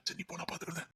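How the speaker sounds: hushed, voiceless whisper; whispered aside. Acted. Son secretly sending girlfriend away behind father's back, whispering. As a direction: whisper so only she hears.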